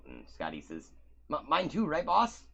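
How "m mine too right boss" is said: high eager wrestler. Acted. GM voices Scotty.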